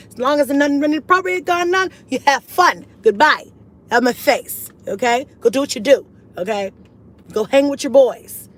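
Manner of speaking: mock stern shooing. Mock shooing son off to play.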